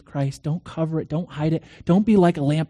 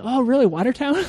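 high-pitched retold surprise; acted "oh, really?" astonishment; surprised interest; 8 semitones higher than usual. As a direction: jump up in pitch, delighted by the coincidence. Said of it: "Like that".

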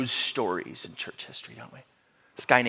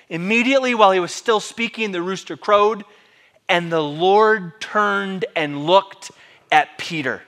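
loud, raised voice slowing to a weighty close; dramatic scripture reading; 7 semitones higher, 9 dB louder than usual. Real, not acted. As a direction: proclaim the verse, stretching out the last part. Reading the climactic verse aloud.

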